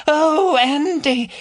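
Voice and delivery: high falsetto mock woman, moaning; mocking female voice. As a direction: camp up a breathless woman's voice. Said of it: "mimicked a female voice".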